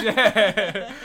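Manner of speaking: loud laughing sign-off. Real, not acted. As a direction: laugh through a fake outro. Mock podcast sign-off laughing.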